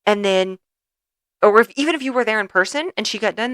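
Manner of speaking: usual speaking voice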